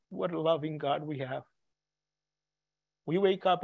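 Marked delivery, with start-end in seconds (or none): 0.1-1.4 soft echo. Real, not acted. Preacher echoing his own praise to the congregation, hushed and tender. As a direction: repeat it softer and hushed.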